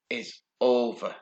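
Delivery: ordinary talk, nothing marked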